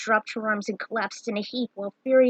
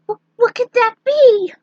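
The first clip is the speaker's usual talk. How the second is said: much higher and louder, a scared gasping question; frightened stammer; 12 semitones higher, 11 dB louder than usual. Acted. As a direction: stumble over the first word in fear. Tara sees red eyes, stammers.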